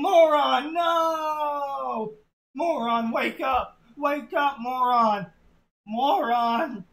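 loud, high, desperate scream; anguished scream. Acted. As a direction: beg him to wake up, yelling. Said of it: "and screamed".

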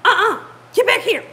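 loud, high mock command to dogs; imitating an alarmed dog owner; imitated yelling. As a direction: call the dogs back sharply. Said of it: "He was yelling at him too".

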